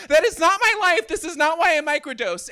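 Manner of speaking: high, loud comic outrage; worked-up, shouted objection; indignant protest. Acted. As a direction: protest it at the top of the rant. Climax of dating app rant.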